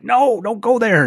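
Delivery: high, slow pleading call; calling out to the bull. Retold or imitated. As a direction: plead with the bull to turn back. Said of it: "I'm like".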